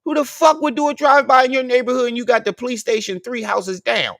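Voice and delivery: high, loud disbelieving yell; incredulous shout. Real, not acted. Arguing with the clip man, high incredulous yell. Mocking the drive-by claim.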